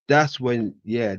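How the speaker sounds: ordinary delivery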